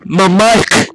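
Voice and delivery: shout. Real, not acted. Friend's ear blown by mic.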